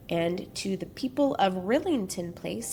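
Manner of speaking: normal talking voice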